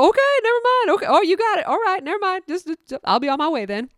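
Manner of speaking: very high, put-on voice retreating; hammed-up sheepish retreat; backing-off coworker. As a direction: imitate a friend sheepishly backing off. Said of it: "Jen, I was like".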